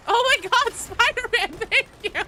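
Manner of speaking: high, loud laughing thanks; excited shout. Real, not acted. Streamer squeals thanks to Spider-Man, excited. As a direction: squeal gratitude at the donor. Another donation, big thanks.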